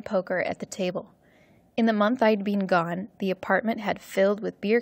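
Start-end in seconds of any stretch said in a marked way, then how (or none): none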